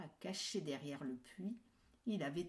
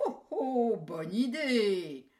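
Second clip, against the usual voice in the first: much louder, slow gleeful wolf; delighted wolf; 4 semitones higher, 12 dB louder, slower than usual. Acted. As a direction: gloat over the idea.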